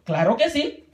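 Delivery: normal talking voice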